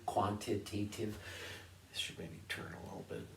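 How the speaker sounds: usual speaking voice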